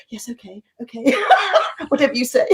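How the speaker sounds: lightly voiced obedient child, half laughing; meek child. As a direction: give in like a chastened child, through a laugh. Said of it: "they revert to being a child".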